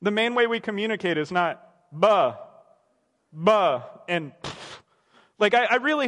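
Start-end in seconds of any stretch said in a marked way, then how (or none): 1.9-3.8 put-on lower, louder baby babble sounds; toddler babble.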